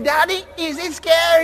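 high-pitched imitation of a scared kid; mock child's question to daddy; little child. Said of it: "Then the little children come".

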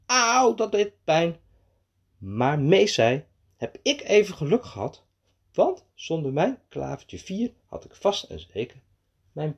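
put-on lively, lifted children's storytelling; animated story narration. A narrator's reading. Narrator telling of Mees tripping over a branch, animated storytelling. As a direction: play up each mishap for young listeners.

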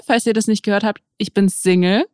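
usual speaking voice

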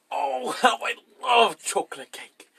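loud, high, drawn-out gush; hammed-up rapture over a favourite treat; gushing delight. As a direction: savour it slowly and loudly, theatrically. Performing chocolate cake monologue.

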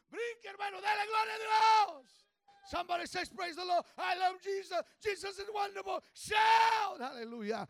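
high, loud preaching shout; fiery preaching. Real, not acted. Preacher urges the congregation to jump and praise, loud shouting. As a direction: lift the room into praise.

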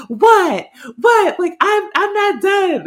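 very high, loud squawk of protest; mock indignant yelling. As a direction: imitate someone refusing to drop a fight. Acting out Sam arguing back.

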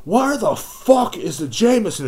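high, loud, drawn-out demand; imitated scream. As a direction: mimic a rude customer yelling across a store. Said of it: "she screams this".

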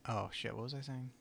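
tipsy, loose delivery. Real, not acted. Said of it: "I'm getting drunk".

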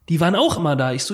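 ordinary talk, nothing marked

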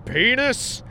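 pitched sharply up, rising; mock escalation. Principal tops the student's answer, mock escalation. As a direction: raise it to top the last one.

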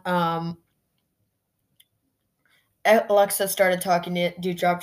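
normal talking voice

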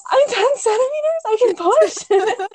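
high, loud, slow disbelief; drawn-out amazed realization; astonished. Retold or imitated. Hearing from the midwife she can push, high astonished disbelief. Told she is fully dilated.

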